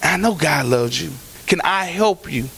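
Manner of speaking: gently reaching out to a struggling church member; gentle. Shown as a demonstration. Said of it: "go to them gently and say".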